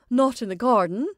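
loud, high startled question; shocked interjection; alarmed interruption. A narrator's reading. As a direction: cut in with sudden fright. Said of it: "interrupted her mother".

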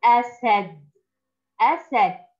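أسد spoken slowly and clearly for the class; clear model reading. Shown as a demonstration. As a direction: model the word أسد syllable by syllable. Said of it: "أول كلمة". (نطق واضح لكلمة أسد)

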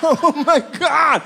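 high, very slow, loud squeal of awe; high, very slow, loud daughter squealing in awe; hammed-up amazed shout. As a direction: shout it out like a stunned teen.

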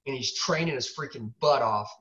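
ordinary talk, nothing marked

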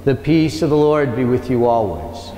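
usual speaking voice

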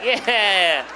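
over-keen goody two-shoes cheer, drawn out. Acted. Goody two-shoes cheering a work topic on a night out, over-keen. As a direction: cheer it like an eager swot, stretching it out. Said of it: "some goody two-shoes is like".

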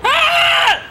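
short high, loud shriek; sudden scream. Pretend. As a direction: let out a loud, high yelp. Sudden scream in comic bit.

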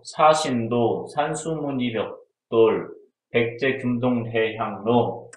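slow, word by word, as if dictating; slow careful listing for note-taking; slow dictation. Shown as a demonstration. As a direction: spell each item out slowly for them to copy. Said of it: "얘네를 진짜 이대로 써줘".

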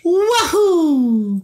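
squeaky video game hero cheer; Mario impression. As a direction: whoop like the plumber jumping. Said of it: "like Super Mario".

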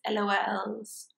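usual speaking voice

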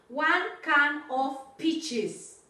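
One can of peaches said very slowly, each word clear; slow, careful classroom model; slow model phrase. Shown as a demonstration. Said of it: "Ojo con la gramat-, ma-, gramática".

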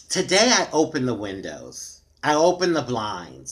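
normal talking voice

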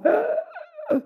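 a high, thin imitated gasp from the knock; baby's dazed gasp. Father imitating his baby's dazed cry after the fall. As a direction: mimic the baby's stunned little cry. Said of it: "estaba así como".

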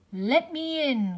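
loud, slow demanding cry; wolf crying out. A narrator's reading. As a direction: yell it at the door. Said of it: "cried the wolf".

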